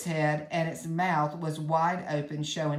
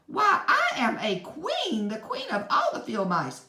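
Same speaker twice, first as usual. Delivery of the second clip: high, louder, proud squeak; proud mouse queen; 11 semitones higher, 4 dB louder than usual. A narrator's reading. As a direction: announce yourself as queen of the mice. Mouse queen declares her rank.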